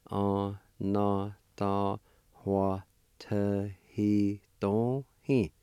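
Anatahuatahidohe drawn out very slowly, syllable by syllable; teacher slowing Anatahuatahidohe for beginners; slow, broken into syllables. Shown as a demonstration. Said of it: "it's pronounced like this".

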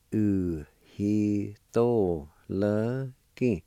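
"Uhitoloki" stretched out very slowly, every syllable drawn long; slow demo pronunciation. Shown as a demonstration. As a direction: drag out "Uhitoloki" for learners to copy. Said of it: "It's pronounced like this".

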